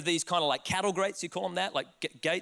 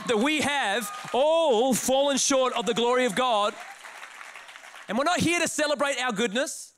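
loud, raised declaration; rousing preaching; 5 semitones higher, 4 dB louder than usual. Real, not acted. Preacher to congregation on God's goodness, rousing. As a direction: proclaim it at full force to a crowd.

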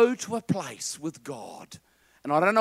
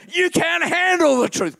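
loud, much higher repeated movie line; Jack Nicholson impression; 9 semitones higher, 5 dB louder than usual. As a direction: hit it harder like the colonel. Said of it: "it's Jack Nicholson".

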